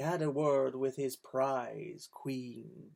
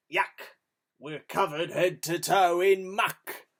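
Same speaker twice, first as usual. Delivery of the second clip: Hugh disgusted; 2 semitones higher, 6 dB louder than usual. A narrator's reading. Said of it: "and Hugh said".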